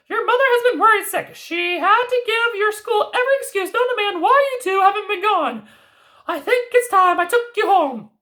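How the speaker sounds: high fairy-tale granny voice; grandmother voice. Acted. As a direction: play the knowing old grandmother. Grandmother character voice.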